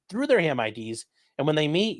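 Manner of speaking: ordinary talk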